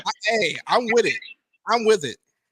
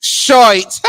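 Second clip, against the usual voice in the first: louder, higher mock New York vowel; New York accent; 5 semitones higher, 5 dB louder than usual. Friend showing how New Yorkers say it, mock accent. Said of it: "They say short".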